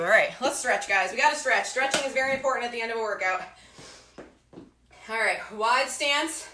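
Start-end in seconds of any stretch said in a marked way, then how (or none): none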